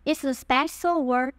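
ordinary talk, nothing marked

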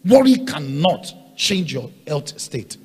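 loud, rising preaching cadence; full-force preaching. Real, not acted. Preacher hammering the congregation about worry, loud. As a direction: drive it home to the congregation.

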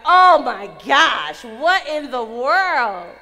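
big drawn-out exclamation; retold loud dismay. Reacting to the girl crying again, loud mock dismay. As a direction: blurt it loud and slow, hammed-up dismay.

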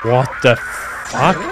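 usual speaking voice

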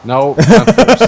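drawn-out protest. Real, not acted. Loud slow protest.

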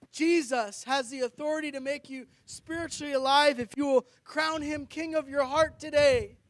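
ordinary talk

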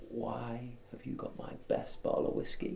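ordinary talk

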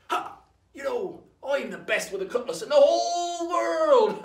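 loud, drawn-out pirate brag; boasting pirate. Acted. Pirate brags to his mates over rum, boastful. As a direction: crow it loud and high, stretching the last words. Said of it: "until one of them goes".

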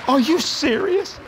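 high, drawn-out mock amazement; astonished disbelief. Waitress hears of an even bigger gift, stunned disbelief.

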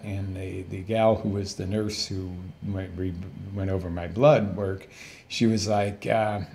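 ordinary talk

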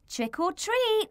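put-on high excited child voice; child crying out. Little ghost Hazy crying out at the door. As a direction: call it out like an eager kid. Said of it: "cried Hazy".

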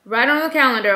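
normal talking voice

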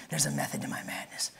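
a sly whispered joke; whispered aside. Real, not acted. Pastor jokes to congregation about long sermon, whispering. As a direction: whisper it softly to the crowd.

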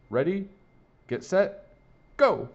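high, loud race start; starter's call. A narrator's reading. Freddie starting the swimming race, calling out. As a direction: call each step apart, loudest on the last.

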